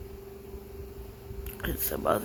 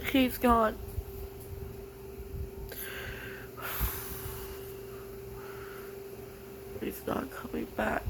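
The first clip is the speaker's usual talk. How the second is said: real tears breaking through, fading to a hush; tearful; 7 dB quieter than usual. Real, not acted. Vlogger grieving her partner, tearful and fading to a near whisper. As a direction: say it as the crying takes over. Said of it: "so much for not crying".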